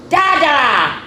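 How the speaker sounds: put-on high, loud toddler call; little child. A little child calling for daddy, high and loud. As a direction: shout "Da-da!" like a little kid.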